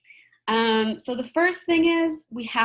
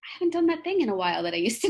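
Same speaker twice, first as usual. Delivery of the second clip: pleased surprise; 3 semitones higher, faster than usual. Retold or imitated. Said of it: "you look back and you're like".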